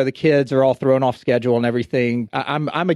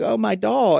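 put-on tearful child; 9 semitones higher, slower than usual. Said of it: "she starts tearing up".

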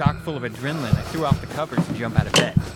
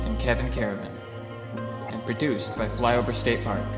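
podcast outro. Real, not acted. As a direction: clear announcer credits read. Outro credits read.

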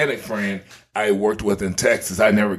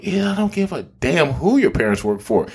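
higher-pitched retold scolding; indignant retort; 6 semitones higher than usual. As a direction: set a friend straight, higher and insistent. Retold angry retort to friend.

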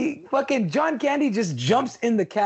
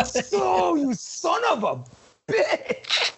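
high, strained outburst of outrage; exasperated cursing; 8 semitones higher, much slower than usual. Acted. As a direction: squeeze out the curse, pitch leaping up. Outraged reaction to Candy's remark.

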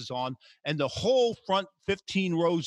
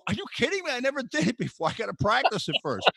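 much higher, alarmed pitch; retold disbelief; 7 semitones higher, faster than usual. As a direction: squeak it out in disbelief. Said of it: "I'm go".

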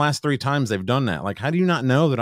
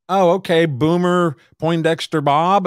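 high, louder, slow announcing of the silly name; mocking worker; 6 semitones higher, 5 dB louder, slower than usual. Drive-thru worker reading out the fake name, slow and mocking. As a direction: drawl the name out like a game-show host. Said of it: "And they're like".

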